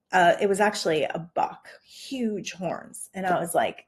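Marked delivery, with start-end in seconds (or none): 1.0-3.1 hushed, as if the deer might hear; awed whisper. Real, not acted. To listener, whispering about the buck's huge horns.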